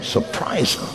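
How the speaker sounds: whispered echo under the breath; whispered aside. Retold or imitated. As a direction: whisper it to yourself, knowingly. Deacon's muttered echo.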